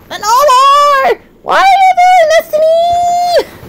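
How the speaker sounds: high, drawn-out plaintive wail; voice cracking. Real, not acted. Streamer pleading with Sentinel, voice cracking high. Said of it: "Why is my voice always cracking?".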